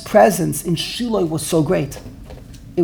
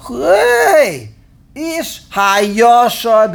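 loud, high, drawn-out hey; mock indignant shout; 5 semitones higher, 5 dB louder, much slower than usual. Congregant objecting to rabbi's rules, mock indignant shout. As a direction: holler it in mock protest.

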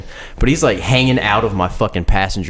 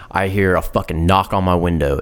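normal talking voice

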